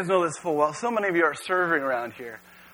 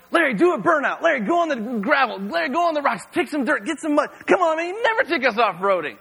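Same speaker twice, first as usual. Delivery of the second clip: higher, eager pleading; teens egging on; 7 semitones higher than usual. Retold or imitated. Teenagers in the Jeep begging the pastor, eager higher pleading. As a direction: pester the driver, pitched up. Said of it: "They're like".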